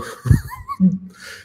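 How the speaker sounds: high-pitched burst of laughter. Real, not acted. Preacher losing track of his teaching, high laugh.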